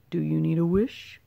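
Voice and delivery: a lightly voiced kindly wizard asking; a hint of an old wizard, gentle question. A narrator's reading. Wizard offering the startled bullfrog a wish, lightly voiced.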